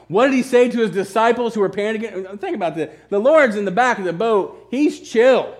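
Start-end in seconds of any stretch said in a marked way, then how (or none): none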